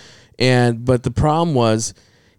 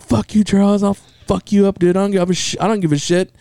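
retold defiant anger; 7 semitones higher than usual. Retold teen quarrel with uncle.

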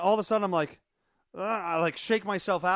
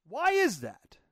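ordinary talk

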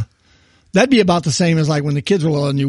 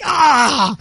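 high pained cry; sudden cramp yelp, higher; getting a leg cramp; 6 semitones higher than usual. Retold or imitated. Retelling leg cramp hitting.

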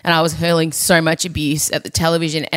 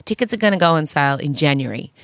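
ordinary talk, nothing marked

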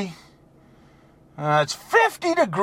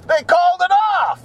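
mock wife; 9 semitones higher than usual. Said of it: "the old ball and chain said".

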